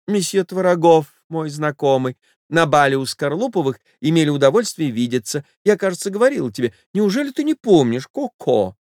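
high, breathless society lady voice; a frightened wife begging him off; flustered lady. Acted. Wife pleading with her lover to leave, high flustered voice. Lady's voice.

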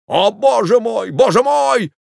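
high, loud despairing cry; panicked wail. Acted. Old man panicking under bed.

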